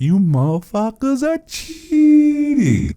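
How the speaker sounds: high, loud, slow outburst; ranting yell. Acted. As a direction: draw it out and yell at them.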